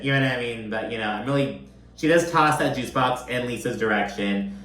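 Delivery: usual speaking voice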